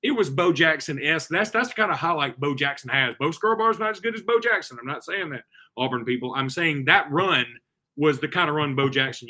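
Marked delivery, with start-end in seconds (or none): none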